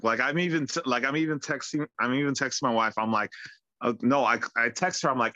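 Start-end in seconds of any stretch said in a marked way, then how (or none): none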